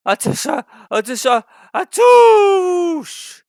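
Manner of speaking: building to a high, loud sneeze. A narrator's reading. Henry sneezing at the boys, big. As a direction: wind up and blast the sneeze.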